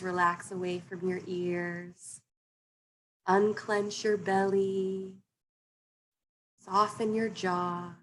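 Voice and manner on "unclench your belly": normal talking voice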